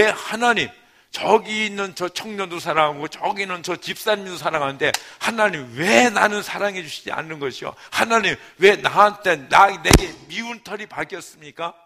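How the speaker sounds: high, loud whining cry to God; complaining prayer. Retold or imitated. A young believer loudly protesting to God about being unloved. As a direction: demand to know why you're unloved, loud and high. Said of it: "뭐, 이런 기도를".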